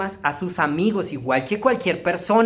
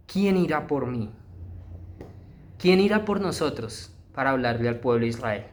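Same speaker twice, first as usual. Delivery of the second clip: lower, soft, longing voice; God's sigh; 6 semitones lower, 4 dB quieter than usual. Acted. God sighing for someone to send, low and soft. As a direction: ask it like a heavy-hearted sigh.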